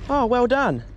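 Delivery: usual speaking voice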